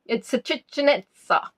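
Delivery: hammed-up Italian accent with added vowels; mock Italian, stretching the opening words; bad Italian accent. Quizmaster jokes about pizza with ham and mushroom, bad Italian accent. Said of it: "some bad Italian accent there".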